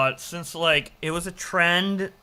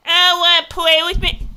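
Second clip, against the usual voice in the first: put-on baby voice; 10 semitones higher, 9 dB louder than usual. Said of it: "the baby voice acting".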